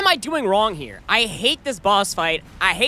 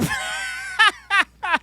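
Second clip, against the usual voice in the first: high-pitched laugh. Real, not acted. Laughing at a pun.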